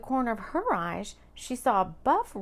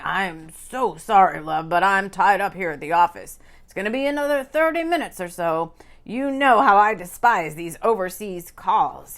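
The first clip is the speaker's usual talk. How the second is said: a weary man stuck at the office; tired man; 2 semitones lower, 9 dB louder than usual. A narrator's reading. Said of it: "sounding tired".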